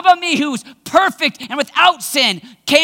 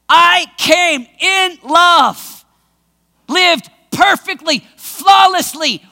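preaching at full force; slower than usual. Acted. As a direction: drive each phrase home.

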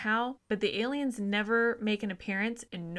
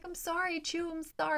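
high, small voice saying sorry; mock sheepish apology; 9 semitones higher than usual. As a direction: squeak out the apologies like a cowed host. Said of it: "They're like".

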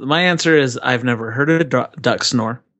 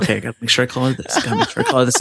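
fast anxious self-talk; much faster than usual. Retold or imitated. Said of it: "I'm like".

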